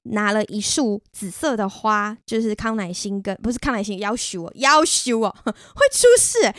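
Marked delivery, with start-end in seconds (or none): 4.6-6.5 high squeal of laughter at own slip; laughing. Real, not acted. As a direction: laugh it off as a blunder. Catching own slip about flowers.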